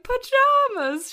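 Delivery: mock delight, stretched out slow; delighted squeal. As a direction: act out a princess thrilled by pajamas. Princess delighted by pajamas.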